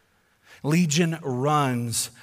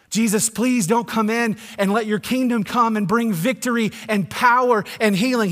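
high, louder demon pleading; 8 semitones higher, 7 dB louder than usual. Retold or imitated. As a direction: beg Jesus to stay away. Demons beg, voiced higher.

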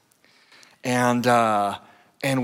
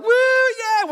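whooping high and loud; teenage players celebrating a touchdown, yelling; cheering; 6 dB louder than usual. Retold or imitated. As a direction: cheer like you just scored. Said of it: "we're like".

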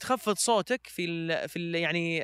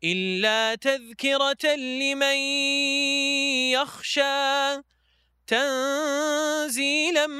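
slow, drawn-out melodic tajweed chant; Quran recitation; 5 semitones higher, 5 dB louder, much slower than usual. Acted. Reciter chanting Surah Taha for the interviewer, slow melodic recitation. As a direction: recite each verse slowly with long held notes, rising high.

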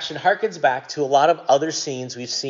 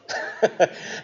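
soft laugh; 4 semitones higher, 4 dB quieter than usual. Real, not acted. Laughs at own joke.

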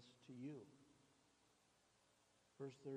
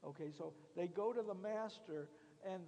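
louder, pressing the outrage; fiery preaching; 4 semitones higher, 6 dB louder than usual. Acted. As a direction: preach it hard to the crowd. Preaching at full force.

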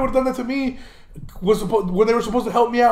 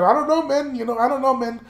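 put-on higher, lightly Mexican-accented dad voice; a touch of a Mexican dad's accent, higher; 4 semitones higher than usual. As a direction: imitate a Mexican dad drawing out "know". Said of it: "Mexican dads love to say the word men".

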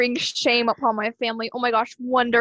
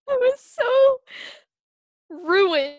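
exaggerated despair; 11 semitones higher, 7 dB louder, much slower than usual. Real, not acted. Dramatic childhood ruined.

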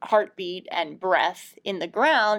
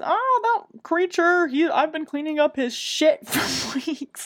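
high, put-on old man's grumble; old janitor; 7 semitones higher than usual. Old janitor telling the kids about the bison, high grumble. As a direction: complain like a fed-up old janitor. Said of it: "old fucking janitor dude".

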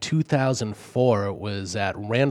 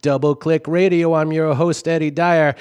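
upbeat, louder radio host patter; 5 semitones higher, 6 dB louder than usual. Acted. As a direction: announce it like a DJ on air. DJ sign-off into next song.

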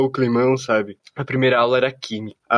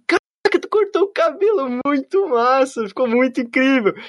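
put-on high, bubbly girl's voice, much higher; imitating an oblivious happy classmate; mock cheerful girl; 12 semitones higher than usual. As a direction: gush over the haircut in a squeaky high voice.

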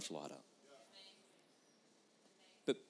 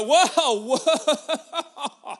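loud, high, laughing mock outrage; mock offended Jesus; 8 semitones higher, 10 dB louder, slower than usual. Mock Jesus scolding the doubting father, laughing outrage. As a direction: ham up a petty offended god. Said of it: "Jesus doesn't go".

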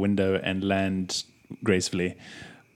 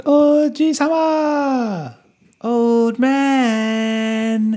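high, long drawn-out call; called-out shout; 12 semitones higher, much slower than usual. Acted. Player's character hailing the old man, high drawn-out call. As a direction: stretch each word out high, calling afar. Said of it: "I shout out".

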